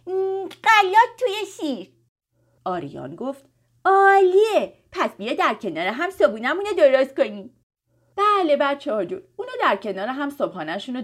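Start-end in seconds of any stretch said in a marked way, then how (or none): 0.1-1.9 put-on high, loud, drawn-out little-girl glee; think, then burst out happily, slowly; excited. A narrator's reading. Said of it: "فکر کرد و با هیجان گفت". 3.8-7.5 put-on loud, high, enthusiastic boy; delighted. A narrator's reading. Boy delighted by sister's answer.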